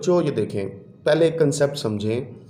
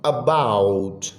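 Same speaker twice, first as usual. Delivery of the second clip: very slow dictation; much slower than usual. Shown as a demonstration. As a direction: read a book about drawn out word by word. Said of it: "ये देखें, ये फिर आओ". (बहुत धीरे, शब्द-शब्द करके पढ़ना)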